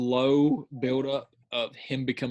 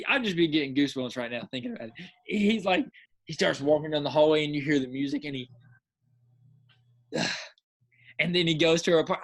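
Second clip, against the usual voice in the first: ordinary delivery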